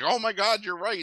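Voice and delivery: sudden high-pitched gasp of agreement; mock amazed realization. Safari man seeing giant dogs on the screen, in a high mock-amazed voice. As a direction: play it wide-eyed, a sudden high jump of surprise. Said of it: "the safari man is like".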